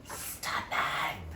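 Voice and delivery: an admiring, drawn-out "Stunning!"; swooning over a cover; gushing stunning. Acted. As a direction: savour the word like a fan. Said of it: "Can we say stunning?".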